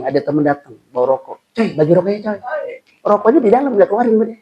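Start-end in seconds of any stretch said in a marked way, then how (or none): 1.5-2.4 a casual, pitched-up plea from a mate; lightly voiced pal asking for a smoke; a slightly higher, pleading buddy voice; friend begging. Friend asks for a cigarette. 3.0-4.3 high, louder mock excuse of a tightwad; stingy man fobbing off his friend, pitched high; stingy smoker.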